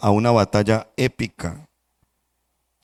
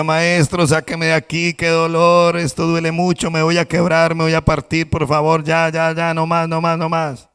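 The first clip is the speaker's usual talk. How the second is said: higher, urgent pleading from the kiln; cup in pain; 6 semitones higher than usual. Acted. Burning cup begs the master to open the kiln, higher and urgent. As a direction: beg to be let out of the heat. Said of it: "yo le decía".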